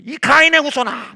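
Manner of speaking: loud accusing cry at himself; shouting condemnation inward. Retold or imitated. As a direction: hurl the words like a curse. Accusing inner voice quoted.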